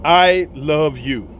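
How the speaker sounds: drawn out at half speed; slow emphatic repeat. Acted. Making sure my sister heard him, slow emphatic declaration. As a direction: repeat it slowly so it sinks in. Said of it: "Let me say it again".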